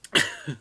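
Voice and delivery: cough-laugh burst; 笑着咳嗽. Real, not acted. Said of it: "thinking about it again".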